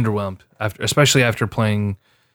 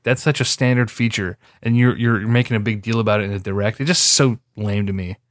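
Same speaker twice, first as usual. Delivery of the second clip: ordinary delivery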